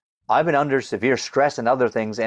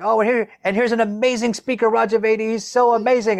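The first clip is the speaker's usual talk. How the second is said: mock emcee intro; 10 semitones higher than usual. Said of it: "Kimberly Crow is, like, saying".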